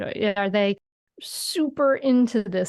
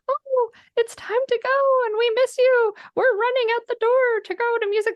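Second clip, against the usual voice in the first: silly talking shoes; 14 semitones higher than usual. Pretend. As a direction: play the child's shoes calling sweetly to hurry. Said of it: "Your shoes saying".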